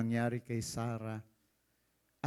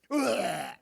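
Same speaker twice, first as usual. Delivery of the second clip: mock retching; 7 semitones higher, 6 dB louder than usual. Imitating a drunk vomiting.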